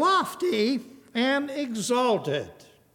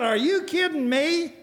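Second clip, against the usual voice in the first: higher-pitched incredulous question; mock astonishment in retelling; retold disbelief; 8 semitones higher than usual. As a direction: replay your amazement, pitch up. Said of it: "I said".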